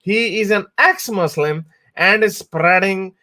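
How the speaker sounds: high, slow, sneering read of a troll's tweet; mocking troll voice. Vlogger reading a hateful tweet aloud, in a high mocking voice. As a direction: ham up the accuser like a clueless troll. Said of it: "it goes".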